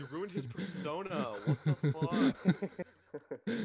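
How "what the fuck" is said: loud, high, long drawn-out disbelief; stretched, rising shout of disbelief; incredulous outburst. Real, not acted. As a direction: cry out in jokey outrage, stretching the words. Joking outrage at ruined persona.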